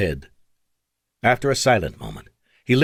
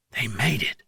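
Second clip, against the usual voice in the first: whispered; 5 dB quieter than usual. A narrator's reading. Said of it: "Vic whispered".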